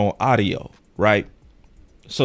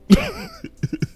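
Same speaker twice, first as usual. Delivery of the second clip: a squealing giggle, very high; high squeal laugh. Real, not acted. A host cracking up at his own joke, high laugh. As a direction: burst into a high-pitched laugh.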